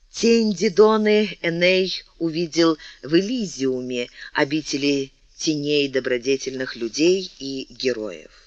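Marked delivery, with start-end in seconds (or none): none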